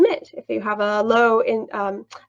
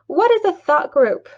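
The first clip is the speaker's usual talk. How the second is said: raised, louder quoted question; mock teacher question; 7 semitones higher, 5 dB louder than usual. Trainer imitating a vague teacher question, louder and higher. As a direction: ask it like a teacher to the class. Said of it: "If I just asked a question like".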